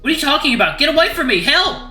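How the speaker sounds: high, loud frantic protest; panicked shouting. Acted. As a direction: yell for help while pulling away. Struggling free, calling for help.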